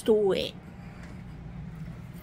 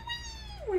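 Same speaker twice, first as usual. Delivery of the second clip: soft, much higher squeak; high toy voice; 10 dB quieter than usual. Pretend. Toy comforting little Lee, high and soft. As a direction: coo like a tiny toy soothing a friend.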